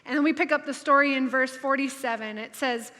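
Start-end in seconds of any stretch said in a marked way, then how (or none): none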